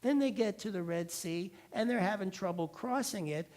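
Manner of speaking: ordinary talk, nothing marked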